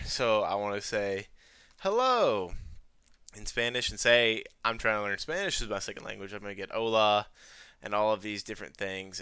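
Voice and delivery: normal talking voice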